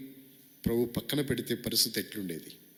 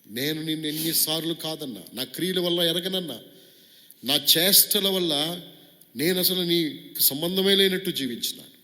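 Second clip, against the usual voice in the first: fervent confession; 6 semitones higher, 4 dB louder than usual. Acted. Model confession prayer building up.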